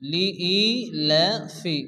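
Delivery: লিইলাফি stretched slowly with a long ই; drawn-out syllables. Shown as a demonstration. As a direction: sound out লিইলাফি slowly, holding the ই. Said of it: "এখানে শুরুতে যে ওয়ার্ডটি দেওয়া আছে লিইলাফি".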